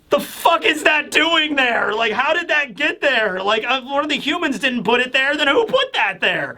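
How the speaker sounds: high-pitched incredulous questioning; mock outrage at a plot hole; baffled disbelief.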